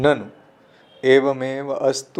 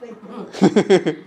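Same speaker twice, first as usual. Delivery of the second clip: short real laugh, pitched up; 6 semitones higher, 3 dB louder than usual. Real, not acted. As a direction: burst out laughing. Laughter after the punchline.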